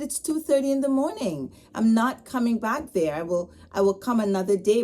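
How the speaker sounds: frustrated complaint to the restaurant worker. Retold or imitated. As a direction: push back at the restaurant, exasperated. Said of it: "I was complaining and frustrated".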